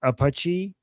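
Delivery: APACHE said "ah-pah-CHEE", open first vowel, rising end; Iranian pronunciation. Shown as a demonstration. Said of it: "how I say".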